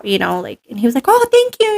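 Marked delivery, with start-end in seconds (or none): 1.1-1.7 very high, lilting imitation of thanks; flattered thanks. Trainer answering a compliment, flattered. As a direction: act out a sweetly flattered reply.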